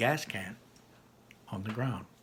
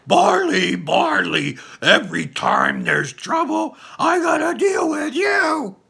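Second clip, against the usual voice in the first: much higher, loud hammy shout of a stepdad; Colt impression; 10 dB louder, slower than usual. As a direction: overplay the scolding boyfriend, high and loud. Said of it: "Is that your Colt impression".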